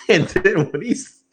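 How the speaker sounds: laughing. Real, not acted. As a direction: laugh through the start of the story. Laughing while retelling the interview.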